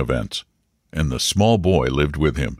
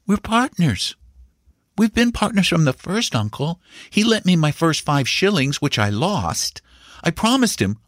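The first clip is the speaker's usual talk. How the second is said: put-on high, pleading child's voice; small boy solemnly explaining his secret, pitched high; high-voiced confiding nephew; earnest young boy; 11 semitones higher than usual. A narrator's reading.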